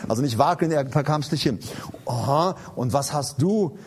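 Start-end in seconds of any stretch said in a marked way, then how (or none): none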